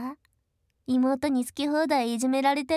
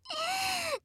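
high shrieking mocking laugh; 6 dB quieter than usual. Sister mocking brother's weakness, high laugh. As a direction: laugh down at him.

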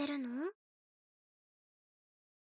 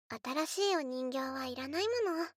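usual speaking voice

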